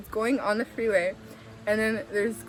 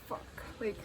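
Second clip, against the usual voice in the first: whispered, breath-only swear; hushed curse; 14 dB quieter than usual. Retold or imitated. Driver to cousin as the car won't slow, hushed curse. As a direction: swear under your breath.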